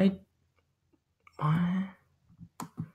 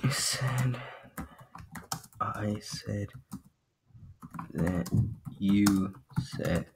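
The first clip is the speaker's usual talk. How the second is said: quiet mumble; 3 semitones lower, 11 dB quieter, much slower than usual. Real, not acted. Quiet tangled mutter.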